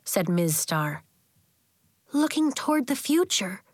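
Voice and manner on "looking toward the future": put-on high, contained child's voice; piped up softly. A narrator's reading. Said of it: "Nori piped up, keeping her voice soft".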